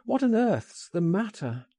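high, urgent question; alarmed cry. A narrator's reading. Man finding his friend shaking, an alarmed cry. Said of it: "I cried".

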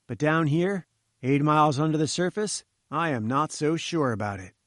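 ordinary delivery, nothing marked

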